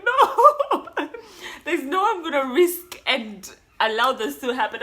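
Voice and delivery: high loud laughing no way; emphatic refusal. Retold or imitated. To herself about the myth, loud amused refusal. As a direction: shout it down with a laugh.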